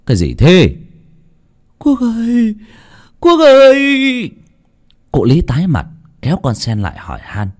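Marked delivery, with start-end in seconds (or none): 0.1-0.8 loud startled demand; yelled question. Acted. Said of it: "thì gào lên". 1.8-4.3 high, drawn-out wail building louder; crying out. Acted. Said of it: "vừa chạy vừa khóc".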